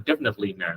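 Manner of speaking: "definitely" with the t of "nit" swallowed; unreleased t. Shown as a demonstration. Teacher shows students the silent t in "definitely", softly. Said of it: "Cái chữ nết mọi người không có đọc chữ thờ đâu".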